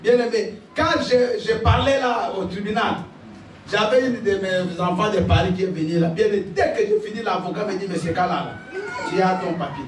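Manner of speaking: usual speaking voice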